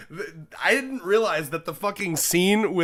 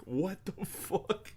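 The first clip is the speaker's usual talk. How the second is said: stunned disbelief; 5 semitones higher, 7 dB quieter, much slower than usual. Retold or imitated. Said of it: "I was like".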